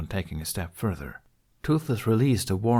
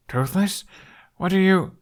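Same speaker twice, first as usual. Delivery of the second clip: much higher, alarmed calling out; startled call; 11 semitones higher, 4 dB louder than usual. A narrator's reading. Hiccup stumbling on the two dragons, high startled call. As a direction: call out in surprise at the scene.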